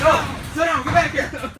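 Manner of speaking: shouting. Acted. Stopping him from leaving.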